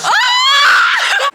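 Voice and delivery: very high, loud scream. Pretend. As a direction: let out a sudden piercing scream. Sudden scream in the tub.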